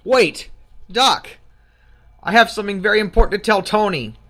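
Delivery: urgent. Acted. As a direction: blurt it out in alarm. Marcus wakes up in alarm.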